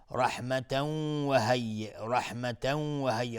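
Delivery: «رحمتا و هی» with the tanween merged into a hummed waw; idgham with ghunna. Shown as a demonstration. Teacher models idgham twice, tanween of «رحمتا» hummed into the waw. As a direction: blend the tanween of «رحمتا» into «و» with a held nasal hum. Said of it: "تنوین بعدش واو ادغام با غنه".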